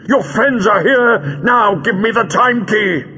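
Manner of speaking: high, impatient villain demand. A narrator's reading. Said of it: "said Greal impatiently".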